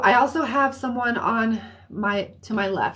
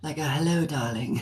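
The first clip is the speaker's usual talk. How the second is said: a deep, quieter try at a raspy woman's hello darling; low raspy voice; 5 semitones lower, 4 dB quieter than usual. Shown as a demonstration. Medium retrying the spirit's raspy voice for the sitter, lower and softer. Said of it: "I can go pretty low".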